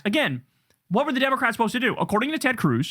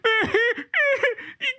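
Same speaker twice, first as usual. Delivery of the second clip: squealing mock laugh, far higher; a shrill gloating cackle to mock them; 12 semitones higher than usual. Host mocking Republicans with a high laugh.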